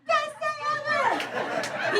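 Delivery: yelps way up high with joy; a loser's high-pitched whoop of pure delight; ecstatic loser guy. Retold or imitated. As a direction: whoop like the luckiest loser alive.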